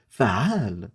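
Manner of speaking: light, sing-song advert announcer; shampoo ad voice. Pretend. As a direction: sell it like a Middle East shampoo ad. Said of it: "Tu fais bien les pubs de merde".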